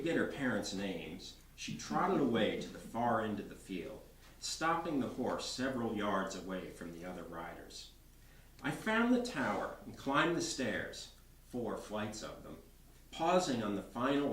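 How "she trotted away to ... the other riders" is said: ordinary delivery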